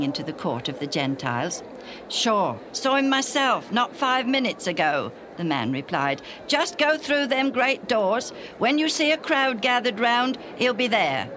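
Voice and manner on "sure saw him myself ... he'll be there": much higher, louder, casual local; a helpful passer-by pointing the way; breezy stranger. A narrator's reading. Stranger in the temple answers.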